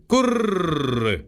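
a rising second coo, „gurr", dropping at the end. Acted. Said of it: "Da sagten die Waldtauben".

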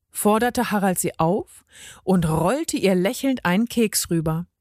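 ordinary delivery

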